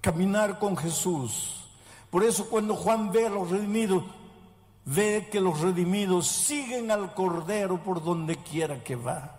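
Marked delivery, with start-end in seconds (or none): 0.0-8.0 rising sermon appeal. Acted. Pastor urging the congregation toward the hope of Christ's return, in a rising preaching voice. Sermon climax, higher and slower.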